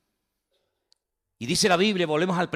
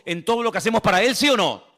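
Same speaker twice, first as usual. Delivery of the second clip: loud, lifted call to the crowd; rousing preaching; 6 semitones higher, 7 dB louder than usual. Real, not acted. Preacher rousing the congregation, loud. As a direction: drive it home to the church.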